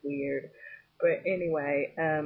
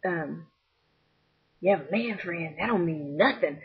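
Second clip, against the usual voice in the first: put-on old pimp; slower than usual. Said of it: "he was like".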